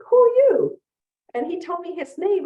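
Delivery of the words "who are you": high, loud startled question; mock-startled lawyer answering a stranger child; surprised reply. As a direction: jump up in pitch, caught off guard. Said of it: "And I'm like".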